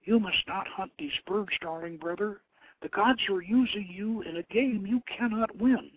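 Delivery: a sister begging her brother to stay; pleading sister. Acted. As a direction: warn her brother gently but urgently. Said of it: "Pugat knew that her sibling was in grave danger".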